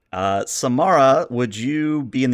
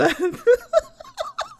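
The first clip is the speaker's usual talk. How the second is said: shrieking high burst of laughter; high helpless laugh. Real, not acted. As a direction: player breaking up at the captain's threat. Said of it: "You broke me".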